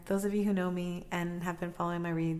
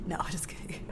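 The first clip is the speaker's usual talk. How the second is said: whispered laugh; 9 dB quieter, faster than usual. Real, not acted.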